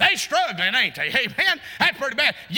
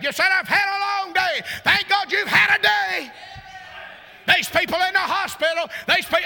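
full-force preaching; 5 semitones higher than usual. Acted. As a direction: fire up the congregation, shouting.

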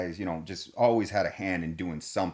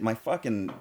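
usual speaking voice

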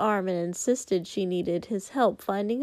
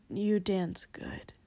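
put-on slow drunken whisper; whispered compliment; 3 semitones lower, 7 dB quieter, slower than usual. Tipsy Shoto complimenting Izuku's dancing, slow whisper. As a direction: murmur it shyly, slowly.

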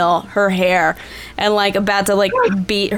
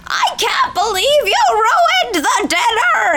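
a cook screeching over a ruined dinner; furious scream; 14 semitones higher than usual. Acted. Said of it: "like, screaming".

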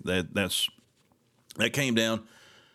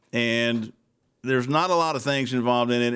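normal talking voice

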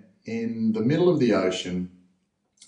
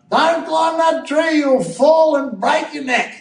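put-on much higher, slow, loud fretting old nana; nana yelling; 5 dB louder, slower than usual. Grandmother yelling at a child up a tree: high, slow mock scolding. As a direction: shriek a warning like a worried grandmother. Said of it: "they yell out".

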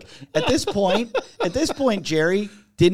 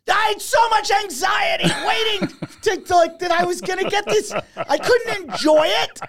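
high-pitched, strained imitation of an upset friend; mock outraged wail. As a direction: wail the complaint way up high, hammed-up. Retold victim's anguished complaint to prankster.

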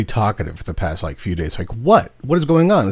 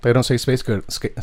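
usual speaking voice

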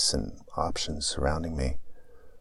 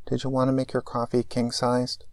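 crackly drive-thru speaker voice; like it's coming through a tinny box; mechanical speaker voice; 8 semitones higher than usual. Acted. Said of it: "A mechanical voice crackles".

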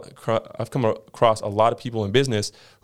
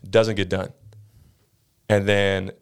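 normal talking voice

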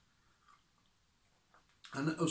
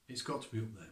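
softer repeat, letting it sink in; quiet echo; 3 semitones lower, 7 dB quieter, faster than usual. Shown as a demonstration. Preacher repeating his point to the congregation, quieter and reflective. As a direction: say it again, dropping your voice.

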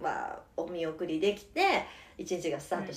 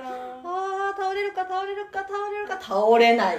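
high sing-song play-by-play, laughing; breathless rising goal commentary; 10 semitones higher, 4 dB louder, slower than usual. Pretend. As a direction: call each wobble like a match finish. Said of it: "ずっと実況して".

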